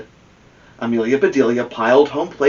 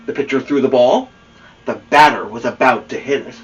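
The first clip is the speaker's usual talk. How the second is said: usual speaking voice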